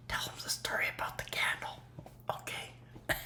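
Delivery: fast whispered voice in his head; inner voice whisper. Pretend. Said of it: "He's actually telling you something".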